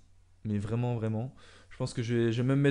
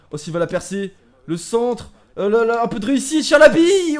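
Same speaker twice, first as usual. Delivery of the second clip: excited shouting; 10 semitones higher, 14 dB louder than usual. Real, not acted. Chance on goal in football game.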